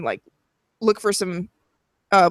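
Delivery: ordinary delivery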